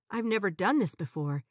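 ordinary delivery, nothing marked

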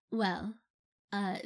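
ordinary delivery

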